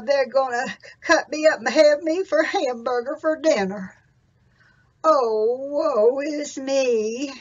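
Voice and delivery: loud, slow lament; loud slow cow voice moaning woe is me; woeful old cow. Acted. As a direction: wail like an old cow facing the butcher.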